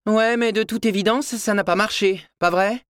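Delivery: voix de mère aiguë, un brin ironique; réplique moqueuse. A narrator's reading. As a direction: renvoyer la pique à son fils. La mère réplique à son fils. (une mère qui taquine son fils)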